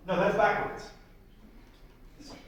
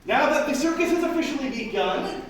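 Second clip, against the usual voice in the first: higher, louder showman opener; ringmaster announcement; 6 semitones higher, 4 dB louder than usual. Acted. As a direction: kick off the act grandly. Ringmaster announces the next act.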